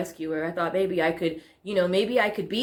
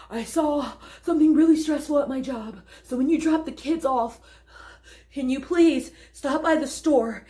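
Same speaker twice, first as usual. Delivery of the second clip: higher-pitched, put-on voice of a stressed-out ex; demanding ex-wife; 5 semitones higher than usual. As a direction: plead for a favour in a high, hammed-up voice. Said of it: "with the ex-wife who needed".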